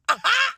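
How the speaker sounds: sudden high-pitched laugh; a short squeal of a laugh; burst of high laughter. Real, not acted. Reacting to the verse.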